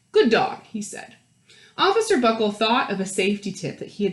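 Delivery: put-on lively read-aloud voice for children; animated picture-book narrator; storybook narration. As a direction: read it like story time to a class.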